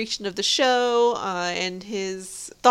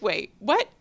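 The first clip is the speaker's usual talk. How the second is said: surprised disbelief; 10 semitones higher than usual. Retold or imitated. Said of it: "I was just like".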